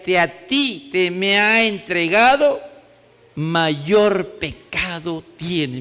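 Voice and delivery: slow, weighty reading of the verse; solemn Bible reading voice; scripture reading. Acted. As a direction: read it as sacred words, slowing at the end. Reads Jesus' words from scripture.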